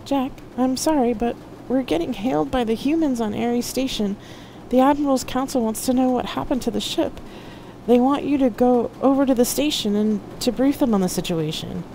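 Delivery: high-voiced, careful messenger; apologetic Loki. A narrator's reading. Loki telling Jack the station is calling, apologetic. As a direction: relay the message in a high, apologetic voice. Narrator plays Loki relaying a message.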